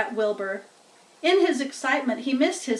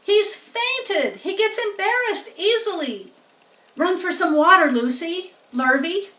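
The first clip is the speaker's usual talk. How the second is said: higher, a hint of urgent ordering; urgent farmer; 7 semitones higher, 5 dB louder than usual. A narrator's reading. Farmer orders hand to fetch water, urgent. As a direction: send for water quickly.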